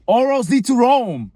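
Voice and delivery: loud, high-pitched insistence; exasperated outburst. Acted. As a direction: blurt it out, fed up. Repeating the phrase louder.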